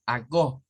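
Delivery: "a go" as "ah-go", pure o; "a go" read the Spanish way; Spanish vowels. Shown as a demonstration. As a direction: open a and short o in "a go". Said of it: "una O del español".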